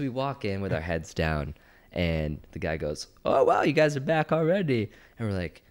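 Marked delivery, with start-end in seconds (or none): 3.2-4.9 high, loud mock amazement; imitating surprised shop worker. Shop guy sees the two customers back so soon, surprised. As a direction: greet returning customers with raised, exaggerated surprise.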